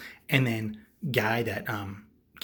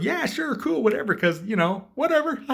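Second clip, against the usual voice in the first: high, loud, goofy go-ahead; hammed-up carefree host; breezy agreement; 7 dB louder than usual. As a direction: agree airily in a put-on voice. Acts out host agreeing casually.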